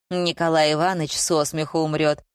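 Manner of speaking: ordinary delivery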